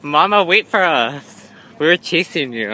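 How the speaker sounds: loud, excited running chatter; playful loud calling. Real, not acted. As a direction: call out while chasing. Chasing mom through the mall.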